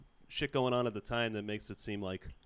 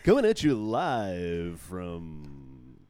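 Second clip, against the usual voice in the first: slow, drawn-out announcer call; ring announcer; 5 semitones lower, 7 dB louder, much slower than usual. Pretend. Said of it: "I'm gonna say everything like a, like a ring announcer".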